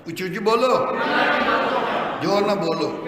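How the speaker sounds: rousing, forceful shout to an audience; loud urging. Real, not acted. Urging congregation to answer louder.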